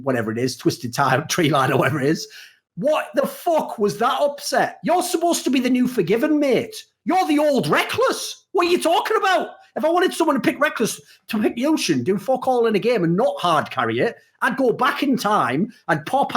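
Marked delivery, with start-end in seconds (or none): 2.8-13.4 exasperated rant. Real, not acted. Co-host ranting at the player Upset, high exasperated outburst. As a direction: hurl it at him in disbelief.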